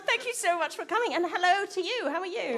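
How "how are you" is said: usual speaking voice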